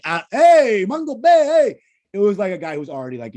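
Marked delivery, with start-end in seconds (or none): 0.3-1.8 loud, high cheer of "Mango Bay"; mock loud greeting. A pretend baguette seller hailing the podcast, loud cheer. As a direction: hail them like a fan.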